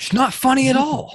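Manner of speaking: loud, high-pitched indignant outburst; indignant protest. Real, not acted. Fan objecting to friends laughing at the lost final, indignant. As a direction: protest loudly, pitch shooting up.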